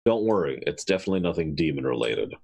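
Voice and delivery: dry sardonic reassurance, dropping low at the end. Acted. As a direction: reassure them with obvious sarcasm. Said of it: "say in a completely sardonic tone".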